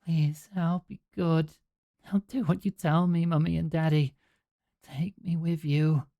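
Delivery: put-on a small child promising to be good; pleading little girl. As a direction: feverish girl begging to be taken along. Hallucinating child begs her parents.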